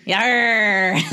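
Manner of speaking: drawn-out, hammed-up pirate growl; playful pirate accent. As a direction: give a long mock pirate yarr. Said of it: "reality of [name]'s pirate accent".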